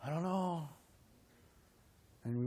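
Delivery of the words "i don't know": hesitant doubt. Retold or imitated. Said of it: "we go".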